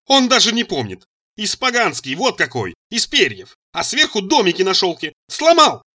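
high-pitched scolding, building to a shout; angry reproach. A narrator's reading. Mother reproaches son over her broken fan, indignantly. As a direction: pile up the grievance, then burst out. Mother lists grievances in quarrel.

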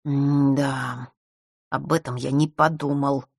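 a mild vexed grumble; annoyed at himself, half aside; lightly voiced chagrin; with annoyance. A narrator's reading. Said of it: "с досадой пробормотал мальчик".

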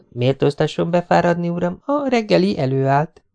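put-on teasingly formal as a young woman; Jessica's voice. A narrator's reading. (játékos, magasabb női hang)

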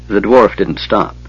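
usual speaking voice

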